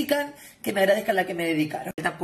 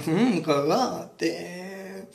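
very slow, low croon; low, slow mock-sultry tease; drawn-out teasing; 4 semitones lower, 4 dB quieter, much slower than usual. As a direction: stretch the word out lingeringly. Drawn-out teasing reply after laugh.

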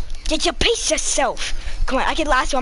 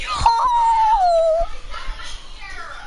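long, high laugh trailing away. Real, not acted. Player who just pushed someone off, high laughing.

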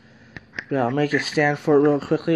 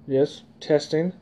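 ordinary talk

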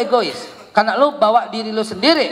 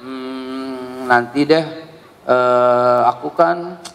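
put-on hesitating kid voice, low and slow; slow hesitant child; 8 semitones lower, much slower than usual. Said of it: "kalau anakmu bilang". (anak ragu-ragu bicara lambat)

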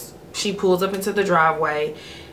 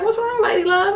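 much higher caring mother; mom's voice. Retold or imitated. Mother asking her daughter what is wrong, high. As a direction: pitch way up, gently concerned. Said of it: "she was like".

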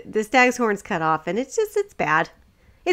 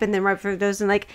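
ordinary delivery

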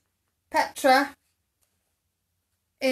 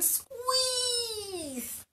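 squeeeezed, held as if squeezing tight; drawn-out squeeze; 12 semitones higher, 7 dB quieter than usual. A narrator's reading. Narrator acts out Shirley hugging Simon, drawn-out squeeze. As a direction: stretch the word "squeezed" long and high.

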